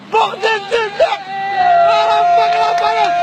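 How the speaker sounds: high, loud, stretched-out swear of awe; amazed outcry. Real, not acted. Whale surfaces right beside the boat, amazed high loud outcry. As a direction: blurt it out as the whale rises.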